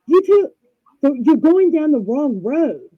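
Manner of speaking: high, loud mock-alarmed husband; acted panic. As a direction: shout a retold warning in alarm. Acts husband panicking at oncoming car.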